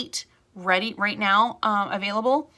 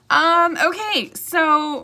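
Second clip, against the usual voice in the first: bright reset; 8 semitones higher, 7 dB louder, much slower than usual. Real, not acted. Resetting to start the topic.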